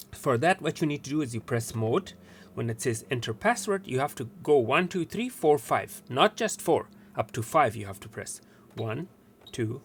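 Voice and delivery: usual speaking voice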